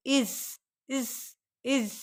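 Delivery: soft slow इज; iz sound. Shown as a demonstration. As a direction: murmur इज, drawn out. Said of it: "अब इस sound". (धीमा और नरम इज)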